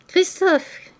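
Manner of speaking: high-pitched call to a servant. A narrator's reading. Old lodger summoning the servant, calling out. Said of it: "cria le père Goriot".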